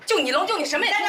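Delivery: fast, high angry jab; angry jibe. Acted. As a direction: fire it at your husband in a rush. 夫妻争吵升级. (又急又高地怒呛)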